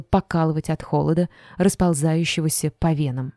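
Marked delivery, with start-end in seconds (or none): none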